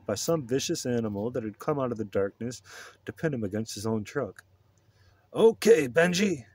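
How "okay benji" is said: loud, higher-pitched call to a dog; firm raised-voice call; shouting. A narrator's reading. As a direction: shout a command across a parking lot. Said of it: "I shouted".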